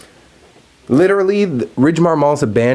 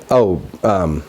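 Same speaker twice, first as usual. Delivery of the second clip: usual speaking voice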